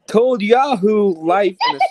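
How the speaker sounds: loud, breaking into laughter; laughing while reading. Real, not acted. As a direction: read through the giggles.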